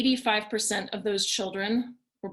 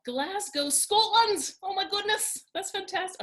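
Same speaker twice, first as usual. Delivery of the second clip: a hammed-up Scottish accent, much higher; bad Scottish accent; 11 semitones higher than usual. Greeting Glasgow viewers in a jokey Scottish accent. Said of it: "terrible Scottish accent".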